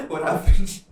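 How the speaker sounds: real giggling question; laughing. Real, not acted. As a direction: ask through bursts of laughter. Cracking up while asking the cohost.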